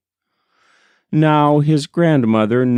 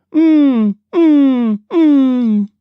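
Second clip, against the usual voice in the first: high, loud hummed owl call; owl hooting hum; 6 dB louder, much slower than usual. A narrator's reading. An unseen owl calling at the fish traps, high hummed mm. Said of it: "was the sound he heard".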